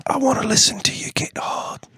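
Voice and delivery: breathy ASMR whisper. Pretend. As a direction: whisper close and intimate.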